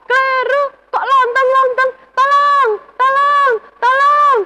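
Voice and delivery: put-on terrified kid goat yelling for help; screaming for help. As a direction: shriek for help as the little goat. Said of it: "anak kambing itu segera berteriak".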